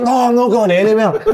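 high, laughing no; laughing refusal. Retold or imitated. Prankster refusing the chasing guard, laughing high. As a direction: refuse while cracking up. Laughing defiance during chase.

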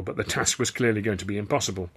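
ordinary delivery, nothing marked

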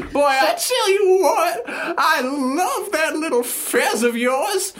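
put-on slow, very high old lady; eager granny, drawn out and squeaky; Sherry Ovaltine. Wife telling husband her cruise plans, very high old-lady voice. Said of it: "Should I do a voice?".

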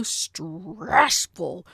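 drawn-out growl inside the word; slow, growled exasperation. Real, not acted. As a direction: growl the start of "stressful". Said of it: "You heard the growl".

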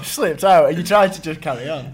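laughing. Real, not acted. Teasing friend about the burp.

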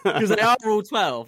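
loud, high emphatic jab; excited emphasis. Real, not acted. Mocking the young fans.

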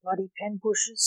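plain reading voice

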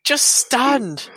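hammed-up manager bellowing at his player; mock touchline shout. Fan imitating a manager yelling at his striker. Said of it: "he shouts".

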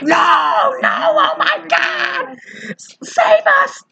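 very high, slow terrified wail; hammed-up shrieking plea; mock panic scream. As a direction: scream for rescue like a horror victim. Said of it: "they're like".

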